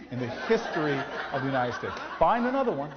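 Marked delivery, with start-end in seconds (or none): 2.2-2.9 playful challenge. Real, not acted. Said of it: "I challenge you".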